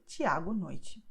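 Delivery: Tiago noite with a ch sound for each T; slow demonstration of ch in Tiago and noite. Shown as a demonstration. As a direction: start Tiago and end noite with ch, slowly. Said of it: "the sound will be like ch".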